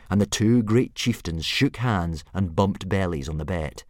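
ordinary delivery, nothing marked

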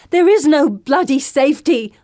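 furious high shout. Acted. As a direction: yell it at them. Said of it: "I shout".

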